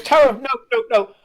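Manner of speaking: high, loud desperate cry; anguished scream. Acted. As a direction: scream her name as she falls. Enola redoes the cry.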